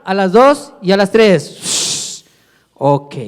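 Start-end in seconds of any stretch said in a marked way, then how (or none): 1.5-2.2 a soft unvoiced shh like a blade leaving its sheath; soft sword-drawing shh; sword drawn hiss. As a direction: draw the sword with a quiet shh. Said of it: "¿Se acuerdan el shh?".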